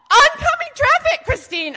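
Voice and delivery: loud, very high alarmed cry; mock panic. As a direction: yelp a warning about an imminent crash. Dad panics as car faces traffic.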